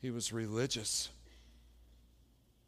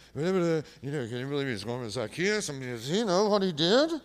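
lightly voiced gossiping crowd; a hint of scandalized whispering neighbors; mild mock crowd muttering; grumbling crowd; 3 semitones higher, 8 dB louder than usual. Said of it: "the crowd begins to do what".

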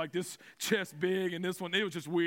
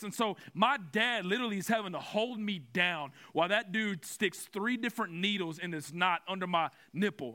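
ordinary delivery

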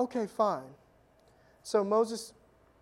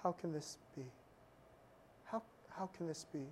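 quieter, lower, broken questioning; 3 semitones lower, 16 dB quieter than usual. Retold or imitated. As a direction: ask it like a proud man whose certainty just cracked. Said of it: "his tone changes from kind of haughty to broken".

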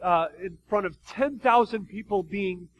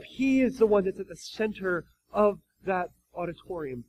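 ordinary talk

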